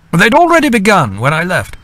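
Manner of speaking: lightly voiced brisk young captain; a hint of an eager messenger; Captain James. A narrator's reading. Captain James telling the captains over hurried breakfast.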